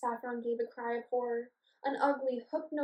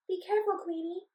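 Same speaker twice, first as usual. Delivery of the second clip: pleading; 8 semitones higher than usual. Acted. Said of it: "pleaded Saffron".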